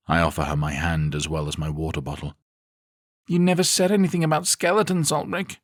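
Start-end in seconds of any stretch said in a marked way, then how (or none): 3.3-5.6 put-on high young girl voice telling him off; scolding young girl. A narrator's reading. Sister scolding her brother, a high girl's voice. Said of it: "she tells me off".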